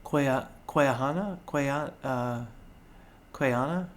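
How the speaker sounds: halting, questioning tries at an unfamiliar name; unsure pronunciation. Real, not acted. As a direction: sound the name out slowly, unsure. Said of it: "maybe we'll pronounce this wrong".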